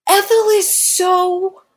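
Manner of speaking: slow, high mocking tone; mocking sing-song. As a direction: drag it out mockingly. Mocking Ethel.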